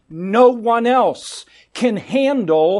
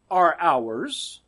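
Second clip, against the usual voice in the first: ordinary delivery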